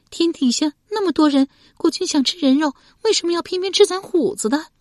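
high, loud wailing protest; high-pitched anguished questioning; distraught wife. A narrator's reading. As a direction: plead against the injustice. Wife argues for son's life.

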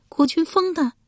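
very high wifely reply; high-pitched character answer; wife's voice. A narrator's reading. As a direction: answer brightly high as the wife. Wife answers husband's question.

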